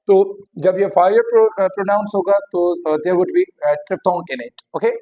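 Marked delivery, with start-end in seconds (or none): none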